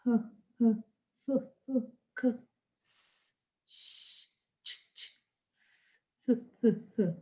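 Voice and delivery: breathy unvoiced consonant sounds, softly; whispered consonant sounds; voiceless sounds. Shown as a demonstration. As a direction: demonstrate each voiceless sound. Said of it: "So sounds like".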